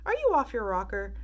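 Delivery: a touch of shock; incredulous cry. A narrator's reading. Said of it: "cried Mister Wonka".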